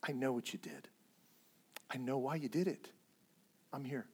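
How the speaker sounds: soft, hushed God saying I'm here; tender reassurance. Acted. God comforting guilty David, soft tender reassurance. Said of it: "he lays his hand upon David like".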